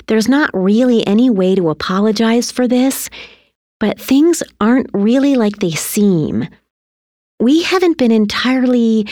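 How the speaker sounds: lightly voiced mother, hedging; apologetic mother. A narrator's reading. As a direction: hesitantly break difficult news. Mother confessing to son.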